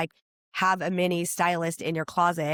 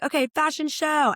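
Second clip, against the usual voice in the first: higher, bright call to start the show; excited announcement; 9 semitones higher than usual. Retold or imitated. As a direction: hype up the fashion show. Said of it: "We're like".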